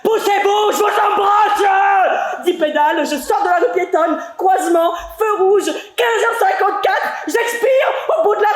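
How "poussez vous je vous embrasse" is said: high, loud, the kiss drawn out slowly; laughing shout. Acted. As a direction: yell it cheerfully while laughing. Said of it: "Je crie".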